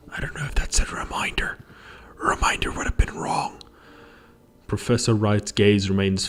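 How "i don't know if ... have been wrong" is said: quick, high whispered aside. Real, not acted. Player puzzling over a reminder, quick whisper.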